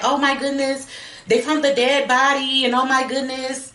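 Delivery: high, mock-delighted exclaiming; happy and ecstatic. Retelling Mary delighted that the body was found, ecstatic. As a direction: imitate a girl's gleeful amazement. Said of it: "she's just happy and ecstatic".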